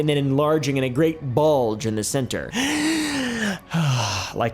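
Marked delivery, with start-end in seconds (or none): 2.6-4.3 dramatic gasp. Acted. Reaction to craft description.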